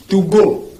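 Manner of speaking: "To go" as too-go, in two clear beats; English pronunciation. Shown as a demonstration. Teacher insisting that pronunciation is what sticks, English pronunciation demo. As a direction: sound out "To go" with an "oo" in the first word. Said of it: "la prononciation s'imprime".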